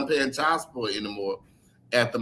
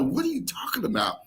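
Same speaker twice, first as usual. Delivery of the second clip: whispered in disbelief; stunned whisper; 8 semitones higher, 4 dB quieter than usual. Retold or imitated. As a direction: breathe it out, shocked and quiet. Shocked reply to mom's news.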